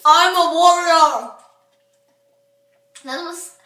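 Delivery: talking through sour face; laughing while sucking candy; candy in mouth. Real, not acted. Seen 2: Left girl squints and grimaces throughout; other covers mouth; speaker unclear.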